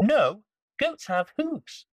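very high little rabbit. Acted. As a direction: speak like a small bouncy rabbit. Rabbit corrects.

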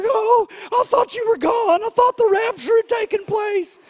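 high frantic cry of relief; panicked mom. Retold or imitated. Mom on the phone, sure of the rapture, shouting in panic. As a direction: voice a terrified mother shouting down the phone. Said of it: "She's weeping on the other end".

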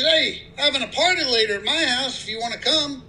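high-pitched voice for the friendly country neighbor. Acted. Guy in pickup greeting new neighbor, high-pitched friendly voice.

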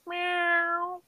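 high, thin imitated meow; cat meow. Hosts trading cat noises, high meow.

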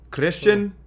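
announcing the name with Spanish flair; announcer-style Spanish pronunciation of the name. Pretend. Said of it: "If you're gonna do a Mexican, like, accent".

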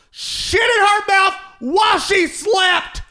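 high, loud disbelieving yell; outraged shout. Real, not acted. Telling friends the dog story, shouting in disbelief. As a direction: shout out the gross ending.

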